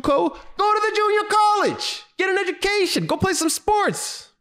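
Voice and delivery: very high-pitched comic scolding; hammed-up high rant at a whiner; exasperated scolding. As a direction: draw out each order in squealing exasperation. Scolding the crying kid in exasperation.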